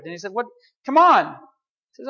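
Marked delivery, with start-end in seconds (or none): 0.3-1.3 high, loud outcry; an indignant, loud complaint; mock protest. Believer protests God's demand.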